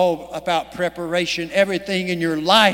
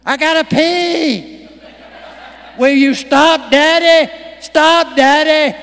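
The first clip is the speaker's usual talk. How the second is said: put-on high, loud whining kid voice; whiny child; 8 semitones higher, 7 dB louder than usual. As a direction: whine like a kid in the back seat. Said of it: "hearing the kids whine in the back seat".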